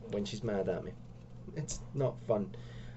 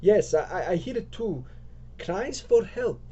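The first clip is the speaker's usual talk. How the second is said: high, stammered, acted alarm; stammer, then draw out the cry in a high voice; startled realisation; 8 semitones higher, 4 dB louder than usual. Lisa hears cries for help.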